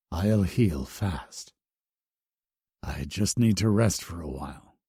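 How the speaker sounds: wounded hero calming Bloom; reassuring. A narrator's reading. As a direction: soothe her gently.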